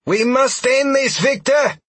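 high, loud yelling woman's voice; fierce warrior woman. A narrator's reading. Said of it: "Sheila yelled".

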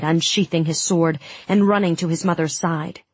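ordinary delivery, nothing marked

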